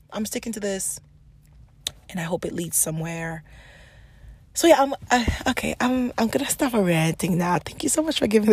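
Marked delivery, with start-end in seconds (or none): 0.1-3.4 soft, whispered resolve; hushed private promise. Real, not acted. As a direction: say it under your breath to yourself. Quiet resolve after venting.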